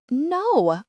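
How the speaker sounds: high wailed protest. A narrator's reading. Patient refusing news of a celebrity split, wailing. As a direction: let it swoop up in dismay. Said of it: "I wail".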